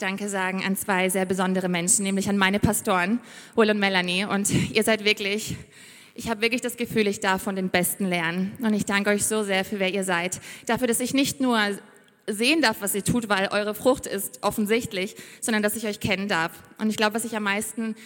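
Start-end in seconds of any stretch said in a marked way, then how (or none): none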